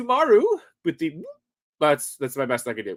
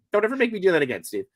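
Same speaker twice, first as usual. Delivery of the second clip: normal talking voice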